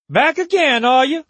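high, loud call across a courtyard. Acted. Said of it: "she called to him".

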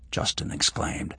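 ordinary delivery

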